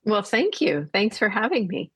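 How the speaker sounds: ordinary talk, nothing marked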